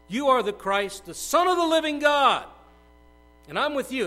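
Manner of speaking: a ringing, fervent shout; bold confession. Acted. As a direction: proclaim it with full conviction. Peter's confession of faith.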